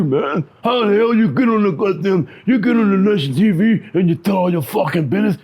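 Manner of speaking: lightly voiced big guy barking at him; hammed-up yell across the bus.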